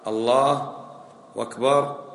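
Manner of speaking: الله أكبر said as allahu wakbar with a waw; الله أكبر with an added waw, wakbar; wrong wakbar. Shown as a demonstration. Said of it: "عطف أكبر على الله".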